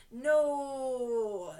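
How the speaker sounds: loud, drawn-out no; emphatic denial. Real, not acted. Stylist debunks her makeup-career dream, loud emphatic denial. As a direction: crush the idea with one long, loud no. Mocking her own naive expectations.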